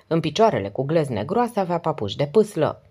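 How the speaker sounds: ordinary delivery, nothing marked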